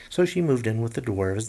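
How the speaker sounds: plain reading voice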